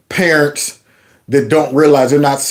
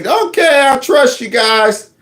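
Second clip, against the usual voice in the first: mock Joe Biden; 10 semitones higher, slower than usual. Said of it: "And he'll just say".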